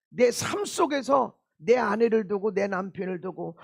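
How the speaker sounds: ordinary delivery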